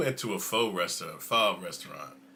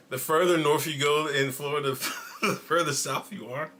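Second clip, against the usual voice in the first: ordinary talk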